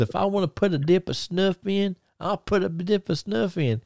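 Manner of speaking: put-on high, defiant Tennessee country accent; Terry Gordy's Tennessee accent. Terry Gordy defying Bill Mercer in a promo, high Tennessee country accent. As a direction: play the stubborn Chattanooga good old boy. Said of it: "real country Ch-, uh, Chattanooga, Tennessee, uh, accent".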